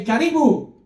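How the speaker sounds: "Karibu" called out high and loud, falling; loud call to the crowd. Shown as a demonstration. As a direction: call "Karibu" to the audience to get a reply. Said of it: "Okay, so I'm gonna say".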